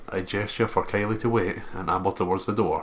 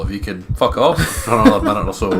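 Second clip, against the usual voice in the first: sarcastic retort; 9 dB louder than usual. Acted. Snapping back at the creepy child.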